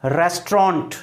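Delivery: रेस्टोरेंट as RES-tront; रेस्टोरेंट with "tr" run together; correct pronunciation. Shown as a demonstration. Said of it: "pronunciation क्या होगा?".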